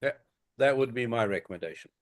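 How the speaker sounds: ordinary talk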